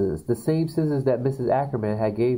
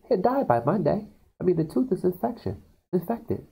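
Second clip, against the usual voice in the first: lightly voiced dentist, pitched high; a hint of a nervous dentist; 9 semitones higher than usual. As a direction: squeak out the dentist's verdict. Dentist quoted on phone.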